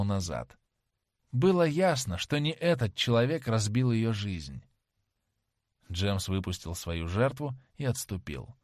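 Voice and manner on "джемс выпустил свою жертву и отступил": ordinary delivery, nothing marked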